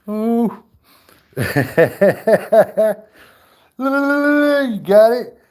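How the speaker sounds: high, loud, laughing shriek; high laughing squeal of horror; mock scream. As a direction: shriek through laughter at a monster. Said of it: "that's what I'm screaming".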